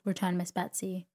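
usual speaking voice

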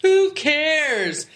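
high, mocking dismissal; dismissive retort. Husband inwardly answering his wife's wish, dismissive. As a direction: exclaim it as an exasperated inner retort. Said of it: "in my head I was like".

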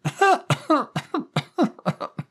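high laugh breaking into hacking coughs; long, acted bout of coughing; coughing fit. A narrator's reading. Smoker Daphne winded after laughing, coughing fit. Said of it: "descended into a coughing fit".

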